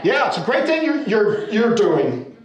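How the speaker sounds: loud, high hearty praise; hammed-up encouragement, drawn out at the end; mock enthusiasm. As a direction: imitate neighbours egging someone on. Imitates keen neighbours who leave him working.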